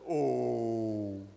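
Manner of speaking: slow, heavy cow brushing off the mouse; indifferent cow. Acted. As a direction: drawl it out like a bored old cow. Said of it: "And the cow said".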